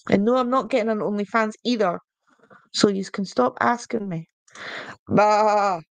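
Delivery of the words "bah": short, punchy bleat "Bah"; sheep bah. Shown as a demonstration. Streamer tries the Yorkshire sheep saying, a short bleat. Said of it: "just the bah".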